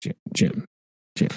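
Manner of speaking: whispered urgent calling of a name; hushed warning call. Retold or imitated. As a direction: hiss the name to warn him.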